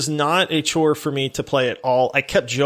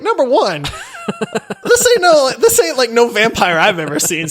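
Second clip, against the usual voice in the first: laughing, higher and louder mock protest; incredulous laughing retort; laughing disbelief; 9 semitones higher, 4 dB louder than usual. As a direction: scoff through laughter at the vampire. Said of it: "And I'm like".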